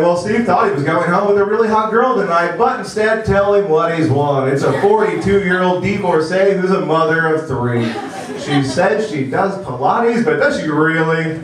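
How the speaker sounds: booming prize announcer; bright, hammed-up game show host. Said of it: "game show announcer voice in my head".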